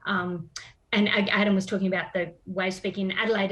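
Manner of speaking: normal talking voice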